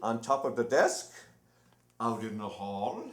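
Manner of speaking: lightly declaimed verse reading; poem recital. Acted. Impersonator reading Einstein's poem to the crowd, declaiming.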